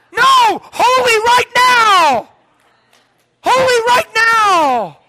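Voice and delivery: revival-tent preaching; full-force preaching. Acted. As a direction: build to a fiery preacher's peak.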